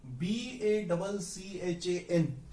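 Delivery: B A double C H A N spelled letter by letter, very slowly; slow spelling out. Shown as a demonstration. Teacher spelling B A double C H A N slowly to students. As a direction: spell the letters out one by one for the class. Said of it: "यहां पर".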